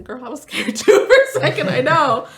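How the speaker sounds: laughing reassurance. Retold or imitated. Laughing with daughter after apology.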